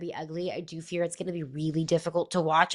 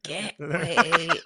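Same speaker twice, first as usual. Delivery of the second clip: put-on laughing old man voice, lower; 4 semitones lower than usual. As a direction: croak it like an old grandpa, laughing. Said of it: "And the old man voice".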